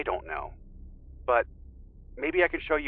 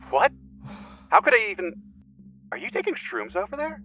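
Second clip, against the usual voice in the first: baffled disbelief; 7 semitones higher, 4 dB louder than usual. Acted. Friend baffled by accusation.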